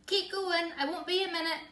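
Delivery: high, loud call over the shoulder; shouting. Acted. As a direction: call back while rushing off. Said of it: "Mum rushes off shouting".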